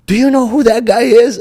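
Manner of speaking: high, loud incredulous question; alarmed disbelief. Retold or imitated. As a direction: imitate a friend stunned by who you picked. Friends alarmed at his opponent.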